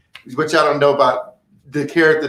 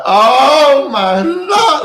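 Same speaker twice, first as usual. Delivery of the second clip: high, loud, stretched-out wail; milk the horror, drawing it out; overly dramatic; 11 semitones higher, 8 dB louder, much slower than usual. Acted. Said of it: "it's just so dramatic".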